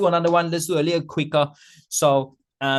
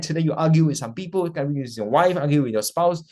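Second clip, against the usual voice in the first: normal talking voice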